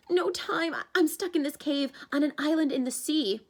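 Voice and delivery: put-on a panicky young satyr; Grover's voice.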